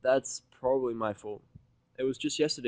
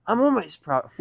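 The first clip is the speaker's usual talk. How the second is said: Rainbow's voice; 11 semitones higher, 12 dB louder, slower than usual. Acted. Rainbow proclaimed.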